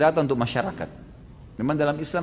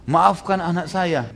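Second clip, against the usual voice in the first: slow pleading; 4 semitones higher, much slower than usual. Acted. Mother begs the caliph.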